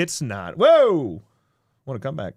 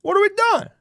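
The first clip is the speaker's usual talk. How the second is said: incredulous; 13 semitones higher, 4 dB louder than usual. Retold or imitated. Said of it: "I was like".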